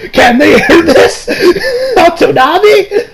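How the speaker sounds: high laughing squeal of disbelief; real giggling, pitch jumping up. Real, not acted. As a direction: laugh it out, can't believe it. Incredulous laughing question.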